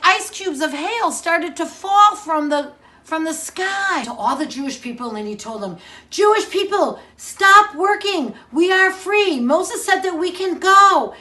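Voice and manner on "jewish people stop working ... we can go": higher, louder rallying call; rally the crowd to leave; excited call. Acted. Moses calls the people out.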